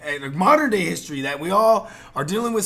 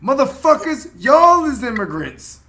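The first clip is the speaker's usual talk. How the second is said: loud callout; 3 semitones higher, 8 dB louder than usual. Acted. Calling out racist Americans.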